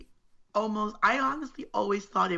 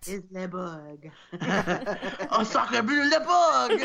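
put-on French accent; slower than usual. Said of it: "They only eat baguettes and drink red wine".

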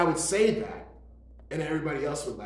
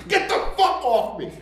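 loud, higher mock shout; 11 semitones higher, 9 dB louder than usual. Husband shouting at his dog, loud mock shout. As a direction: bark it at the dog. Abusive owner yells at dog.

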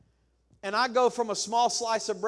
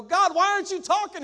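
high, loud mock whine at God; whiny complaint to God; 12 semitones higher, 8 dB louder than usual. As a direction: play the impatient pray-er, pitched way up and loud. Impatient pray-er voiced in sermon.

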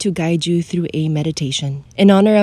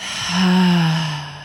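long open-mouthed exhale; audible sigh. Shown as a demonstration. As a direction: demonstration sigh releasing the breath. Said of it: "sigh it out through your mouth".

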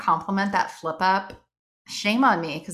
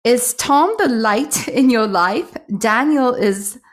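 put-on poor British accent; 6 dB louder than usual. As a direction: read it out in a hammed-up British accent. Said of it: "go with the British accent today, the poor British accent".

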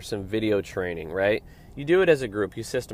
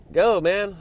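impatient driver; 8 semitones higher, 4 dB louder than usual. Real, not acted. Driver urging car ahead.

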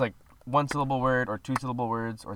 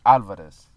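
Alvarez as AL-varez, first syllable stressed; 4 semitones lower, 7 dB louder than usual. Shown as a demonstration. Giving the real way to say his surname, AL-varez. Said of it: "the real way to say it".

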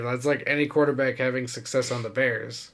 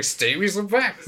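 mock demand; 8 semitones higher than usual. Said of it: "You're just like".

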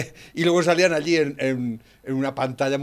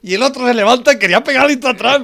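loud, much higher ranting; heated rant; 8 semitones higher, 9 dB louder than usual. Real, not acted. Man ranting to his friend about Trump, loud and heated. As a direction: shout it out in fired-up admiration.

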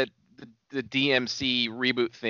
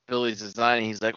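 ordinary delivery

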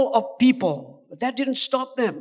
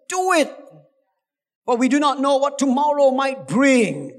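preaching; 4 semitones higher than usual. Acted. Preaching at full force.

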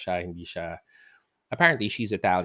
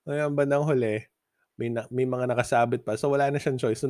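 usual speaking voice

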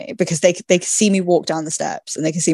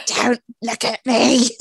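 slow, higher hunchback whine; 5 semitones higher, slower than usual. Retold or imitated. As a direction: creep along as a cowering hunchback. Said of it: "I probably walk like the Hunchback of Notre Dame".

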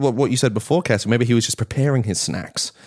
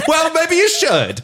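much higher, slow, through laughter; laughing tease; slower than usual. Real, not acted. Podcaster teasing co-host who feels stupid, laughing. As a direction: draw it out while cracking up.

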